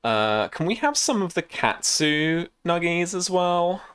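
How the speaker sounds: smug posh hipster asking. Acted. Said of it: "Posh hipster wanker voice".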